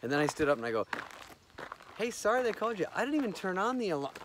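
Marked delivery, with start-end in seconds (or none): 2.0-4.1 high-pitched placating voice; acted apology. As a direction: play an innocent, puzzled apology.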